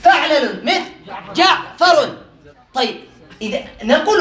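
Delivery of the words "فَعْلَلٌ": «فعلل» with short a twice, fa'-la-lun; due fathe. Shown as a demonstration. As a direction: say «فعلل» as fa'lalun, a on fa and first lam. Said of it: "فتحتان".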